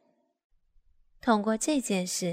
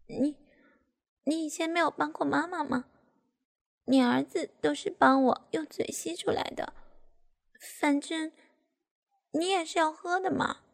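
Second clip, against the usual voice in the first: timid; 6 semitones higher than usual. A narrator's reading. Daughter hesitantly asks her father, timidly. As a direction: ask timidly, pitched high, with pauses. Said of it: "怯生生地对爸爸说".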